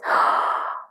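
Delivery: breathy shocked gasp; sharp gasp. Retold or imitated. Realizing her own illness is named, a sudden gasp. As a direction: gasp as if caught off guard. Hearing about rheumatoid arthritis.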